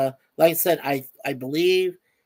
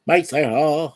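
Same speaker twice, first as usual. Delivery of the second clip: louder, hyped-up name call; catchphrase shout-out; 5 dB louder than usual. Pretend. Host giving viewer a hyped shout-out, calling out. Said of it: "That's what I say".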